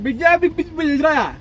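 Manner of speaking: high garbled babble after a knock to the head; concussed nonsense syllables; dazed gibberish. Retold or imitated. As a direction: mouth can't form words, babble it high. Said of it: "And I'm like".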